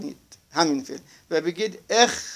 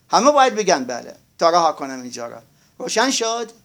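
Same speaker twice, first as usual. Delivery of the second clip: usual speaking voice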